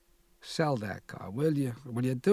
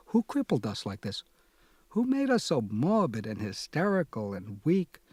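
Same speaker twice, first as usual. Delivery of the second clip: usual speaking voice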